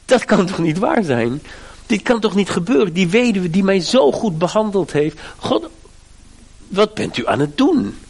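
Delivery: hoog en luider, ongelovig; bewildered protest. Retold or imitated. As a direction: Elia die het niet kan bevatten, hoog uitgesproken. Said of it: "Hij dacht ook". (Elia roept hoog en verbijsterd tot God)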